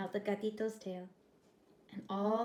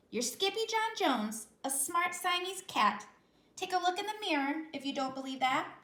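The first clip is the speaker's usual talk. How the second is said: higher, firm mother voice; Mama voice; 8 semitones higher, 3 dB louder than usual. A narrator's reading. Mother reminding her son he is a cat, firm. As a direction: remind him who he is.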